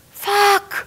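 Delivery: high, loud expletive outburst; blurted curse. Retold or imitated. Guest botching story live on radio, high shouted curse. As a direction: let the swear burst out high.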